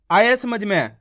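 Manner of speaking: ordinary talk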